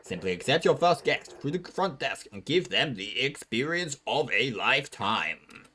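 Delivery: loud, slow storybook narrator; grand mock-serious narration; dramatic narration. A narrator's reading. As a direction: tell it like a mock-epic trailer. Narrator reads dramatic story intro.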